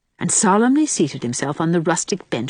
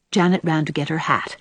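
usual speaking voice

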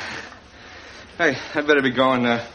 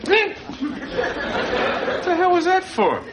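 startled; 13 semitones higher than usual. Acted. Jolted by a shock.

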